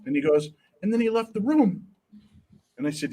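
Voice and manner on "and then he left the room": higher, lightly voiced small son; little boy. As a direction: retell it as an earnest little kid. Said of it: "he's very little".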